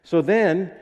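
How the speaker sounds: ordinary delivery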